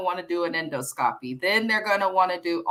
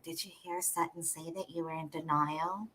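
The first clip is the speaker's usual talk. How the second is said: gossipy Doris; 2 semitones lower, 13 dB quieter than usual. Retold or imitated. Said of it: "you see how the accent changed".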